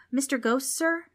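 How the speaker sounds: put-on a small boy asking nervously; hesitant kid voice; timid young boy. Frank politely asks ghost.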